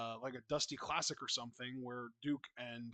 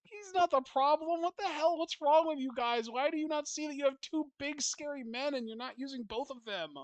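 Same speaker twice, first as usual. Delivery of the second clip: high, loud indignant rant; imitating her own exasperated yelling, much higher and louder; retold outrage; 11 semitones higher, 7 dB louder than usual. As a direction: vent at the screen for poor Duke. Retelling own outraged reaction.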